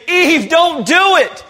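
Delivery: high, loud, urgent plea; pleading shout. Acted. Preacher crying out to Eve from behind a rock, loud and pleading. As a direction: call out to stop her before it's too late. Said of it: "if we could just step up and say".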